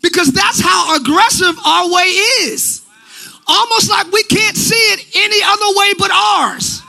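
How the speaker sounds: shouted, tearful preaching climb; preaching with a cry. Acted. Pastor crying out against pride to the church. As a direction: cry it out to the congregation.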